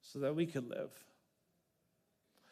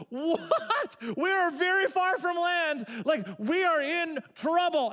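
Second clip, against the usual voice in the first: loud, high, frantic sailors' voices; as if the boat is about to sink; panicked sailors; 11 semitones higher, 5 dB louder, slower than usual. Acted. Sailors far from land fearing for their lives, panicked and loud. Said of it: "And they are freaking out".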